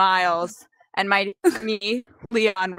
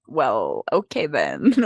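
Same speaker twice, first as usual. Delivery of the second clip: low, deflated retreat; deflated acceptance; 8 semitones lower, slower than usual. Retold or imitated. Telling friend about celebrity's angry look, deflated. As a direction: let it drop, mildly deflated.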